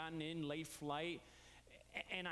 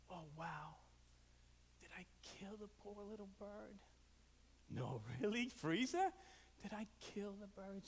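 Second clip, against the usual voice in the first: hushed, much softer worried self-talk; guilty worried whisper; 5 dB quieter than usual. Retold or imitated. Uncle worrying aloud about the frozen bird, hushed panic. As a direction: whisper the dread of having killed it.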